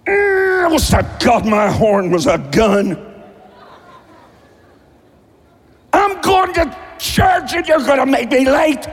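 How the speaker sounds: hammed-up angry churchgoer shouting at traffic; road rage rant. What you did on the way here.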